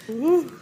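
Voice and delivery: high "ooh", pushed loud; pained moan. Acted. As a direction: groan like a crawling injured guard. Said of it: "He's like".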